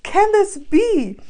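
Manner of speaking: high, loud, slow squeal of delight; thrilled squeal. Retold or imitated. Reliving meeting Gandalf, a high loud thrilled squeal. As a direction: squeal like a fan meeting her hero. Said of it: "so happy".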